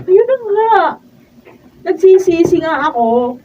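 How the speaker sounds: bitter retort. Acted. Wife bitterly regretting marriage.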